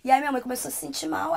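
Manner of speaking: normal talking voice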